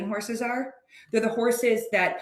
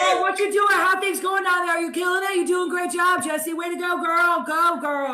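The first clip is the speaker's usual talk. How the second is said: high, gushing Texan cheerleading; loud, pitched-up Texas impression; [name]; 10 semitones higher, 4 dB louder than usual. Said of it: "she's gonna do an impression for you".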